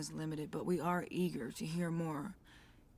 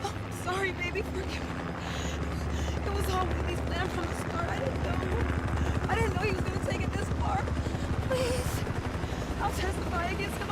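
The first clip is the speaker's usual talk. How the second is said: high, loud, frantic begging; panicked pleading; 11 semitones higher, 7 dB louder than usual. Acted. Woman begs her attacker, panicked loud pleading. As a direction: plead for mercy in a rush.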